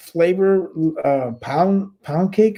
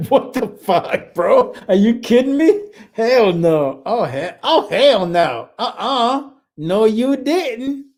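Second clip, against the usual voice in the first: mock disbelief; 8 semitones higher, slower than usual. Real, not acted. Reacting in disbelief to chat.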